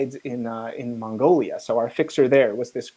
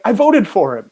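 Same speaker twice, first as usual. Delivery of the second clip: loud, higher eager claim; eager plea; 7 semitones higher, 10 dB louder than usual. Retold or imitated. Traveller pleading with Congo official, eager and loud. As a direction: blurt it out to win him over.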